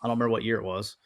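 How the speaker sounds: usual speaking voice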